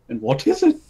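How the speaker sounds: high, surprised voice for the king; a startled king asks; king asking. Acted. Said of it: "the king asked".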